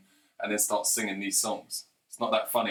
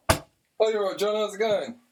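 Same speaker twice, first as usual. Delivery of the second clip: high-pitched, louder surprised-style hello; housemate's greeting; 10 semitones higher, 4 dB louder, slower than usual. Acted. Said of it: "and you're like".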